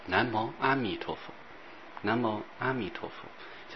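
soft, unhurried Buddha-name recitation; slow recitation. Shown as a demonstration. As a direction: show how to recite slowly and quietly, word by word. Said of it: "就这样慢慢念".

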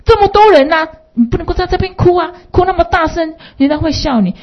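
high, chiding mother's voice. Retold or imitated. Mother telling her son not to cry in the crowd, high voice. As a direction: scold the child lightly, pitched high. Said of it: "妈妈问他说".

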